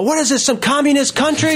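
high-pitched indignant outburst; hammed-up outraged protest; retold yelling. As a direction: cry out in mock outrage, voice way up. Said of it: "you know, yelling".

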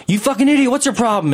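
high re-enacted stranger's rant; mock irate yell; imitated angry yelling. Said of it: "he's still yelling".

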